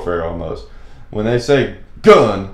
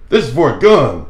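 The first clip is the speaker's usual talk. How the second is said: higher, louder, pointed accusation; suspicious cashier; 7 semitones higher, 7 dB louder than usual. Retold or imitated. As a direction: mimic the cashier pressing the point. Cashier repeats objection, suspicious.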